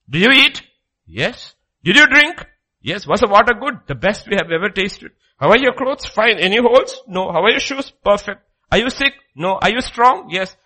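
loud, high interrogating questions and brisk replies; God questioning Israel. Acted. Preacher acting God questioning grumbling Israelites, loud. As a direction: interrogate them like a stern father.

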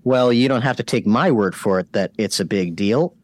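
ordinary talk, nothing marked

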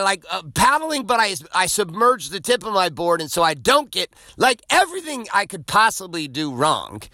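high-pitched, emphatic frustration; exasperated. Retold or imitated. Surfer recounting a failed session, exasperated.

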